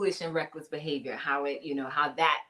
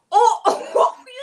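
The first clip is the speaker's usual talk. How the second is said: surprised gasp; 14 semitones higher, 14 dB louder, slower than usual. Real, not acted. Gasps reading a comment.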